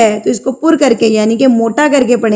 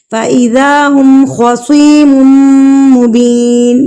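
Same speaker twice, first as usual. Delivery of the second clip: slow, drawn-out tajweed chant; slow melodic Quran recitation for students; 2 semitones higher, 4 dB louder, slower than usual. Shown as a demonstration. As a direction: recite each syllable slowly and melodically.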